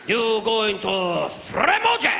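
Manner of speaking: slow, high mock Japanese accent; stretched-out Japanese caricature, bursting on the last word; exaggerated Japanese-accented question. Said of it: "All the way to Japan".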